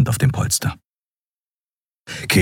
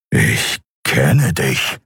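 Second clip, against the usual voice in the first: very slow, lower creature voice; menacing creature; 4 semitones lower, much slower than usual. A narrator's reading. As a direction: draw each word out, low and deliberate. Keru confronts Kai.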